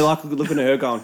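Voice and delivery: normal talking voice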